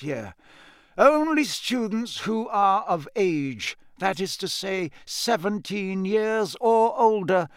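high-pitched, measured oratory; Dumbledore, kindly headmaster. Acted. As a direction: address a whole hall as the old headmaster.

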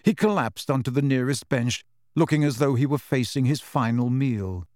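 ordinary delivery, nothing marked

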